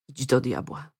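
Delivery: low, hushed curse; said quietly. A narrator's reading. Receptionist cursing the woman across the counter, said quietly. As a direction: drop it soft and low. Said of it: "powiedział cicho".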